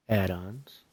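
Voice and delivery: ordinary delivery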